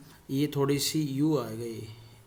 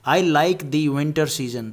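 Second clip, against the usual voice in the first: slow dictation; 5 dB louder, much slower than usual. Shown as a demonstration. Dictating example sentence.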